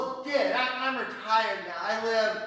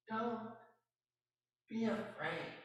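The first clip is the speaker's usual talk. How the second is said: hushed echo of the voice; 10 dB quieter than usual. Acted. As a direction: recall the heavenly words in a hush. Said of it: "That voice".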